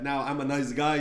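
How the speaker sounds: ordinary talk, nothing marked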